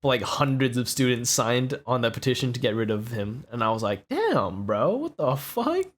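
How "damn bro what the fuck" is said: high, drawn-out mock disbelief; retold disbelief. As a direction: stretch it out, rising at the end. Retold reaction to the petition.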